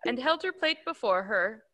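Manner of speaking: usual speaking voice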